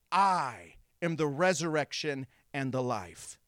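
long, low I, then pauses; weighty declaration. Acted. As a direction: set "I" apart, low and solemn. Voices Jesus answering Martha, first take.

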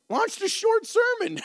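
squeaky high echo of her own line, laughing at it; high-pitched amused echo; high amused repeat. Shown as a demonstration. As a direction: repeat it way up high, tickled by the irony.

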